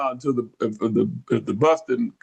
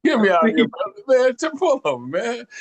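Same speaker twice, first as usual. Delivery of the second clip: alarmed high demand; 9 semitones higher than usual. Retold or imitated. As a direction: insist he pull over, pitch way up. Demanding to be let out.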